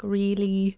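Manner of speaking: slow "really" with a light L. Shown as a demonstration. Coach showing those kind of light L's, light-L "really". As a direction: draw out "really", tongue light on the L. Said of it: "those kind of light L's".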